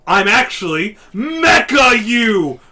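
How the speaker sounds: loud, slow hammed-up reveal; mock dramatic robot reveal; dramatic villain reveal. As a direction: unveil the twist like a cartoon villain. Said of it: "and he's like".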